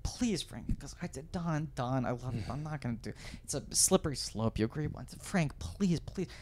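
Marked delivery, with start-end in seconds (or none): 0.0-0.7 quiet begging. Retold or imitated. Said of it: "He goes". 1.3-5.2 weary refusal, softly voiced. 5.2-6.3 whispered pleading. Retold or imitated. [name] whispering to [name], begging please. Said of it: "Stammering, tripping over his words".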